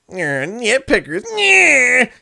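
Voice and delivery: high, slow whiny cartoon voice; Bubble Bass voice. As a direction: whine it out like a smug cartoon fish. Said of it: "can you still do the Bubble Bass impression".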